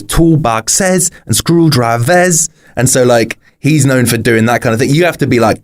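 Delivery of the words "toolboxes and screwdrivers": "Toolboxes and screwdrivers" slow, high, syllables bent to rhyme. Retold or imitated. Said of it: "'cause he goes".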